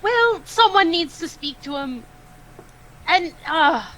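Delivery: insistent loud. Acted. Insisting loudly in argument.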